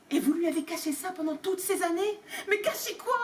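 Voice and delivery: high, baffled questioning; a confused rising plea; bewildered. Acted. Harry bewildered. (Harry perdu, voix aiguë)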